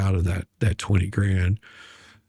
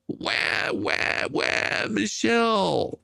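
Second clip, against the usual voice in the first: mock crying; 10 semitones higher, much slower than usual. Mock crying after her letter.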